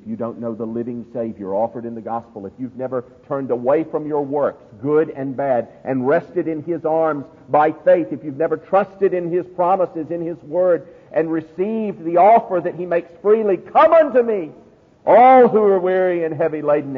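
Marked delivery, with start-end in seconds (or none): 2.5-13.5 preaching that climbs higher and louder; rising, urgent pulpit appeal; forceful preaching. Real, not acted. 13.5-17.0 high, ringing shouted invitation; loud preacher's summons; calling out. Acted. Preacher calls out Christ's invitation.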